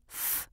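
"f" alone as a long stream of air; f sound. Shown as a demonstration. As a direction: blow air through the lips on "f". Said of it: "air comes out through your lips".

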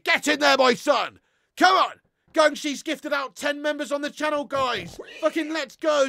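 real delighted yell; excited shout. Real, not acted. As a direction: roar it like a goal went in. Celebrating gifted memberships.

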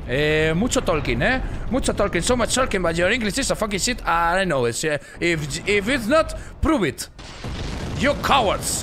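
loud, high mocking English taunt; hammed-up challenge in broken English. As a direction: goad the audience.

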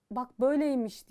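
hammed-up marvel; mock discovery. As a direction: announce a little discovery.

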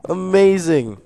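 loud, high, falling exclamation; delighted amazement. Real, not acted. Thrilled at diamonds.

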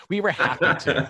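high, loud insistence through laughter; laughing protest. Real, not acted. Designer to snickering colleague about the clients, laughing protest. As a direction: insist it through your own giggles.

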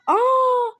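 loud, high "aah!"; sudden high yell; startled cry. Real, not acted. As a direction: cry out as the game goes wrong. Cries out at game mishap.

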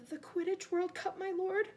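puzzled, high-pitched underling; timid servant. Acted. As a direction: query your master hesitantly. Voices puzzled servant Wormtail.

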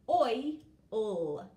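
ordinary delivery

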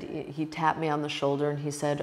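ordinary delivery

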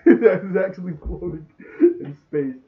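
cracking up at the scene; laughing. Real, not acted. Amused by the floating scene.

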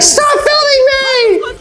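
drawn-out mock wail at the person filming; mock screaming Karen. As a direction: shriek it like the furious lady caught on camera. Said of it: "She's like".

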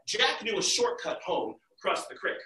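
plain reading voice

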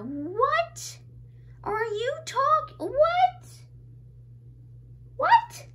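high, rising squeaky questioning; utter disbelief. Real, not acted. Incredulous at own luck.

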